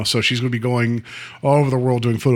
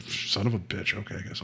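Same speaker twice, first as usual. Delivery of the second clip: low, much softer resigned grumble; grudging mock surrender; 7 semitones lower, 14 dB quieter than usual. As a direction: give in under your breath, as if beaten. Saw victim grudgingly gives in, retold.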